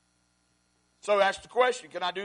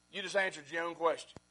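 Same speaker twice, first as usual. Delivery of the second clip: normal talking voice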